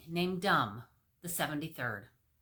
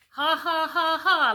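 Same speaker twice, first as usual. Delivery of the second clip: loud high mocking ha ha; mocking laughter; 11 semitones higher, 12 dB louder than usual. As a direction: jeer at the baby's nose. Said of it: "laughed the crowd".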